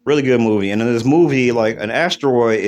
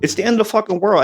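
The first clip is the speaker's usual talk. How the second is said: disbelieving exclamation; 9 semitones higher than usual. Retold or imitated. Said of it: "and we're like".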